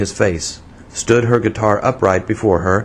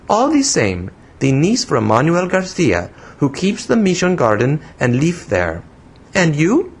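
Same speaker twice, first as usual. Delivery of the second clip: put-on high, childish foreign-accented English; broken English in a little-girl pitch; broken English, infantine; 7 semitones higher than usual. A narrator's reading. Young woman scolding the man who startled her, childish foreign accent. Voices Pepita in dialogue.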